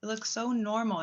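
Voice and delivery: high, gushing imitation of friends; mocking gushing friends. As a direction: squeal it like fake-nice friends. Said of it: "They're like".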